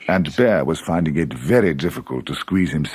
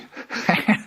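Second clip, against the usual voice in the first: Rabbit's laugh. A narrator's reading. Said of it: "said Rabbit".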